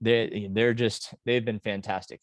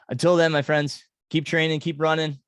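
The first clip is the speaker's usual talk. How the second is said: higher and louder rallying farewell; excited sign-off; 6 semitones higher, 5 dB louder than usual. Real, not acted. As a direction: send them off pumped up. Upbeat podcast sign-off to runners.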